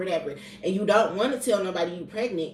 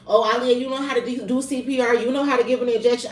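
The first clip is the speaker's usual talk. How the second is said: higher, louder sarcastic mimicry of people reminding her of her training; sarcastic imitation, raised voice; mocking coworkers; 5 semitones higher, 6 dB louder, faster than usual. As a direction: throw their words back, mocking and loud. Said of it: "all that".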